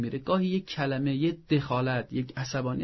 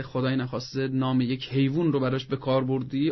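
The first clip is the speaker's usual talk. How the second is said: usual speaking voice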